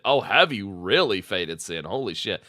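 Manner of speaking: usual speaking voice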